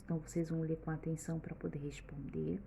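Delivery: hushed instruction, voice dropped low; whispered aside. Real, not acted. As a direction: whisper it softly to the class, unvoiced. Teacher aside to the children.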